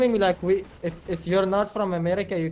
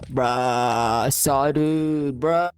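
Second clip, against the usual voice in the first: put-on frat boy; 5 semitones lower, much slower than usual. Doing his best American accent, exaggerated frat boy. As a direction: drag out the bruh like a frat bro. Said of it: "You want frat boy?".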